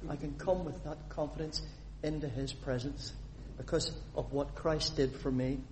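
normal talking voice

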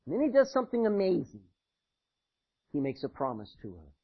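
normal talking voice

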